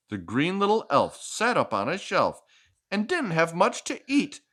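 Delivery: lively picture-book read-aloud; reading to a child. Acted. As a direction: read it like a bedtime story for a little one.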